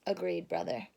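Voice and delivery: usual speaking voice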